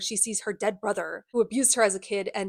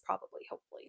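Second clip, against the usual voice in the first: hushed, hopeful whisper under the breath; whispered aside; 14 dB quieter, faster than usual. Real, not acted. Whispering a hopeful aside to viewers. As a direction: drop to a whisper for the hopeful guess.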